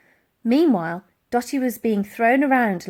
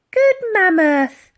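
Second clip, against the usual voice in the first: put-on girl's voice; 10 semitones higher, 9 dB louder, slower than usual. A narrator's reading. Girl praising the mammoth.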